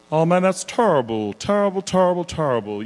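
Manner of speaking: celebrity impression, starting high then dropping; Charles Barkley. Impressionist showing a voice he picked up, Charles Barkley impression. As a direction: repeat it in a put-on voice, pitch falling away at the end. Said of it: "when Charles Barkley, when I first heard, heard him talk".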